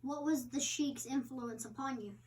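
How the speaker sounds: plain reading voice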